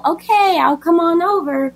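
lightly high, easygoing midwife; lightly voiced calm midwife. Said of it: "she's like".

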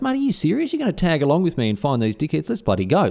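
delighted disbelief. Retold or imitated. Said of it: "He's like, he goes".

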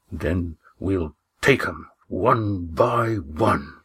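slow, hoarse whisper full of menace; fierce whisper. Acted. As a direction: whisper it like a threat, very slowly. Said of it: "He spoke with a ferocity".

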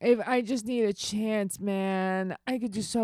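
pleading, slurred addict impression; drug addict voice. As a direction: do the strung-out addict voice.

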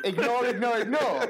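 high, laughing repeated plea; laughing through it. Retold or imitated. Teacher to pupil, laughing while repeating advice. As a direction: keep the advice going while cracking up.

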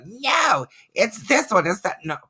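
high-pitched mock protest; mock arguing commenters. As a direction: imitate bickering online commenters. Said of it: "you're probably all sounding off in the comments".

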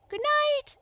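very high, loud small turtle voice; a tiny creature piping back goodnight; squeaky little turtle, loud. A narrator's reading. Little turtle answers frog.

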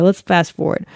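ordinary delivery

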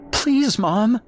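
put-on high-pitched child near tears; tearful plea. A narrator's reading. Boy begging his mother, starting to tear up. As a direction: beg like a boy starting to cry. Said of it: "now starting to tear up".

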